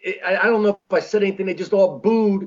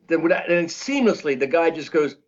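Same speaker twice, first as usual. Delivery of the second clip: normal talking voice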